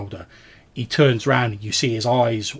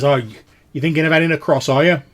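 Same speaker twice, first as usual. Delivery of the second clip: quick, loud, higher ferryman voice; inquiring ferryman; 5 semitones higher, 9 dB louder than usual. Acted. Ferryman asks travellers about crossing, loud and quick. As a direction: ask briskly and loudly as the ferryman. Game master plays the ferryman.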